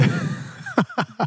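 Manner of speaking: high, sudden real laugh; burst of laughter. Real, not acted. Reacting to Tom's return.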